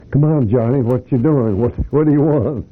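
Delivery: voice calling back. Retold or imitated. Boss calls back to the worker at his door, imitated high-pitched callout. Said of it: "a voice came back".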